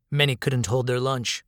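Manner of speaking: ordinary delivery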